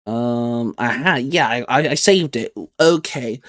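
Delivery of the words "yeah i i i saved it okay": ordinary delivery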